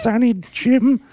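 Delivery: put-on high old granny voice; old lady voice. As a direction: croon it like a scolding granny. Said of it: "Can you do an old lady voice".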